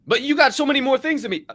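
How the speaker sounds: high eager protest. Acted. Acted exchange between two strangers.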